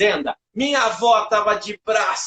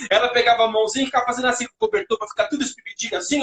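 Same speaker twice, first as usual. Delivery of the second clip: ordinary delivery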